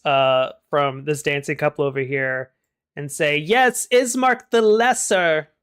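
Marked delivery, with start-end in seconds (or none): 3.4-5.5 high-pitched feminine character voice. A narrator's reading. Dancing woman butts into the introduction, high feminine voice. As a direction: put on a high, drawn-out woman's voice. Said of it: "you hear a feminine voice".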